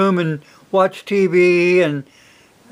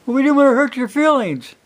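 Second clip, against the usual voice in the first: put-on timid kids; 8 semitones higher than usual. Kids' reply voiced by dad.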